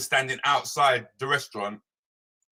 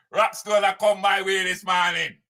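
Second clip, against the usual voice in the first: shout; 7 semitones higher, 5 dB louder than usual. Real, not acted. Hyping a caller's name.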